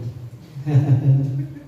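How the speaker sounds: low acted-out demon laugh; mock sinister chuckle. Possessed person opens eyes at praying minister, low sinister chuckle.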